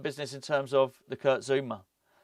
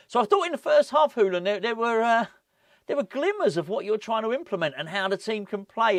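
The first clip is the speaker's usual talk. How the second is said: upbeat, higher imitation of the interviewer; chirpy positive TV questioner, lightly done; mock interviewer voice; 6 semitones higher than usual. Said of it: "So he said".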